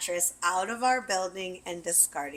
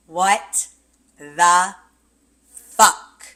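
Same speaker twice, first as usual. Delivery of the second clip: loud shocked what the fuck, rising in volume; shocked yell; 13 dB louder, much slower than usual. Retold or imitated. Discovering cat mess on her bed, shocked yell. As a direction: yell it as you find the mess.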